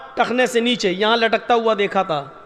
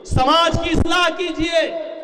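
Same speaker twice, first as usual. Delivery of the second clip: preaching exhortation; 7 semitones higher, slower than usual. Real, not acted. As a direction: rouse the crowd.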